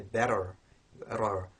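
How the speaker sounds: BETTER with an American flapped T; American BETTER, tongue flap and final R; American English. Shown as a demonstration. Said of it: "in American English you might hear".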